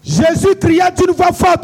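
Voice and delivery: loud, high-pitched rallying shout; full-voiced, high declamation; shouted preacher's call. Real, not acted. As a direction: shout the verse to set off the crowd. Preacher shouts cue to congregation.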